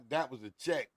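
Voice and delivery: usual speaking voice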